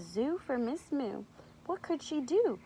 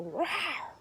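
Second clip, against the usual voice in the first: higher, playful mock roar; mild pretend lion's roar; 4 semitones higher than usual. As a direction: roar playfully for small children. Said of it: "it likes to go".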